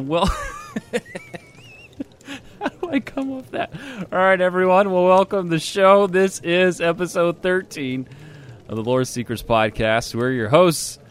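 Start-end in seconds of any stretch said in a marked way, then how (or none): 4.1-11.0 loud, upbeat show opening; host announcer. Acted. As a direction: welcome everyone with energy.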